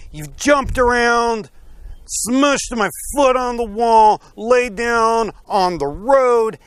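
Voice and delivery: high, loud acted rant; enraged accusation. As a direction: rage at the donkey, drawing out the grievances. Enraged Balaam answers the talking donkey.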